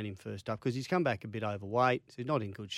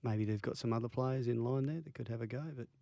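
hushed, low-key and much softer; near whisper; 3 semitones lower, 8 dB quieter than usual. Real, not acted. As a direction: drop the voice as if keeping the opinion quiet. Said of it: "Why are you whispering?".

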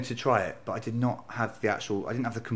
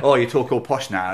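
mocking family; 3 semitones higher, 9 dB louder than usual. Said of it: "There was a".

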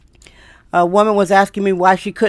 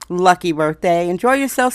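ordinary delivery

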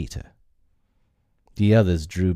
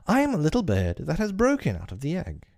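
put-on high, bright boyish voice; Peter's bold answer; 9 semitones higher than usual. Peter answers Hook's question in a high boyish voice. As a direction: toss the answer off proudly like a boy. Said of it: "Peter answered at a venture".

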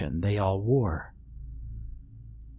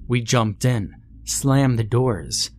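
plain reading voice

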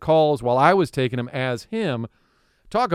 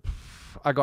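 ordinary talk

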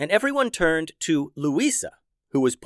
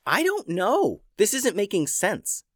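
put-on higher, puzzled girl's voice; girl character voice; 8 semitones higher than usual. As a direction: play the confused sister, pitched up.

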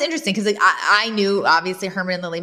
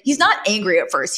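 ordinary delivery

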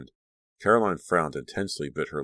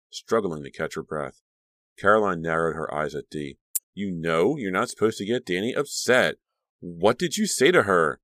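usual speaking voice

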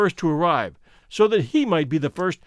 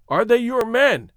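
more insistent, pitched up; impatient; 6 semitones higher, 4 dB louder than usual. A narrator's reading. Man repeats his question when companion does not answer, impatient. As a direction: press the question with mild impatience. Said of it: "he repeated impatiently".

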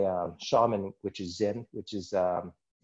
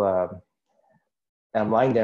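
ordinary talk, nothing marked

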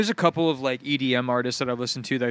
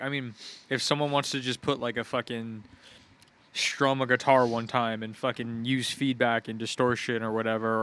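usual speaking voice

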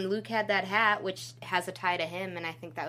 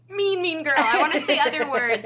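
indignant; 6 semitones higher, 9 dB louder than usual. Real, not acted. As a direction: spit out the insult. Venting about a character.

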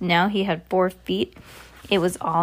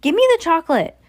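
loud, high demanding shout; 10 semitones higher, 6 dB louder, much faster than usual. A narrator's reading. Bruno demanding chocolate from the witch, high shout. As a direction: demand it loudly, pitched way up. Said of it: "shouted Bruno".

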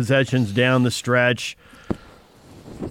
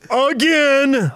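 all-caps yell; 11 semitones higher than usual. Shown as a demonstration. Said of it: "That's why I had to yell".

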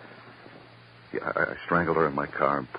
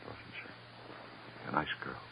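soft insinuation; 4 semitones lower, 15 dB quieter, much slower than usual. Acted. Detective softly baiting suspect.

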